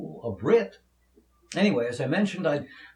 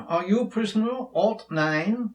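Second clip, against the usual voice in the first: put-on a light British accent, pitched up into a question; 7 semitones higher than usual. Manacled prisoner confronting newest abductee, in a light British accent. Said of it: "gonna have to break out my British accent".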